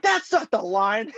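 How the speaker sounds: high, slow protest; protesting fan. Retold or imitated. Fan correcting the actor's line, protesting. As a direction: object in a high, drawn-out voice.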